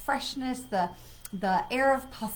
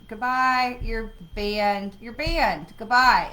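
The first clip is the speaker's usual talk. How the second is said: dismissive; 8 dB louder, slower than usual. Real, not acted. Banning a spammer on stream.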